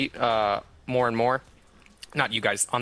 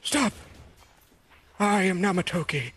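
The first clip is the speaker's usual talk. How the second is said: much higher, pleading yell to stop the knife; desperate cry; 7 semitones higher than usual. Acted. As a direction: shout out to save the hostage. Hostage reveals himself, knife shown.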